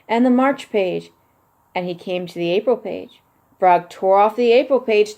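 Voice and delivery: put-on lively children's book narration; picture book read-aloud. A narrator's reading. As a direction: read it like bedtime story time for kids.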